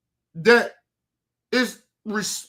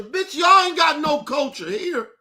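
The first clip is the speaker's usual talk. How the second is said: heated shout; 6 semitones higher than usual. Real, not acted. Ranting at immigrants about culture.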